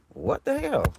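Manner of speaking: sudden high startled what the hell. Real, not acted. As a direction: jolt up, caught off guard. Startled by police passing.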